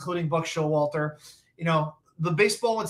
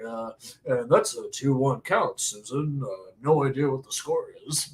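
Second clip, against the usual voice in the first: John Sterling impression; 5 semitones lower than usual. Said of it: "God bless John Sterling".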